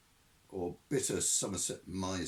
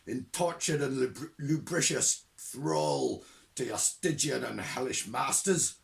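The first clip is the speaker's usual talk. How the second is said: loud, high hellfire ranting; old witchhunter thundering down the phone at a supposed demon; Shadwell's rant; 9 semitones higher, 7 dB louder than usual. Acted. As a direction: rail against the spawn of darkness, slowing on the worst words.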